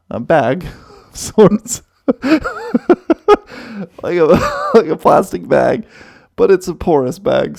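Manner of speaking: words broken up by real laughter; giggling through the embarrassing part; laughing while telling. Real, not acted. Telling her friends about puking in the truck, laughing.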